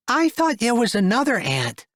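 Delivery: put-on high curious little girl. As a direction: ask like a puzzled child. Child Patty voiced.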